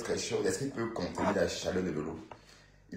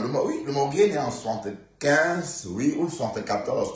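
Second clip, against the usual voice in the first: emphatic correction; 6 semitones higher, 5 dB louder than usual. Shown as a demonstration. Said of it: "non, qu'est-ce qu'il raconte?".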